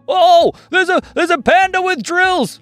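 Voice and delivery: high, loud gasped alarm; acted shock, pitched high; startled customer. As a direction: stammer in astonishment at the sight. Startled customer sees panda.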